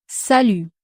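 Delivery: "Salut" with French u and no final T; silent final t. Shown as a demonstration. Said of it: "You don't have to pronounce the final T".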